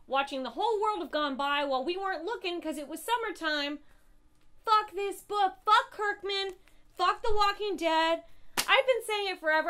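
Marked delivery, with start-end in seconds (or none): 4.7-9.7 loud, furious cursing; real rage. Real, not acted. Reviewer raging at Kirkman and the comic, loud fury. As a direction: shout it out fed up. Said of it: "I am so full of rage".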